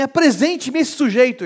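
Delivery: alto e agudo, exigente; exigência indignada. Acted. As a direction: exige que apareça o culpado. Paulo exige o culpado. (Paulo exigindo ver o sedutor)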